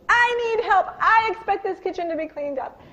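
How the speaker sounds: high, loud commanding demand; firm yell. Retold or imitated. Mother to her messy kids, a loud firm command. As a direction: lay down the law to the kids, loudly. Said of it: "Then I went into I mode".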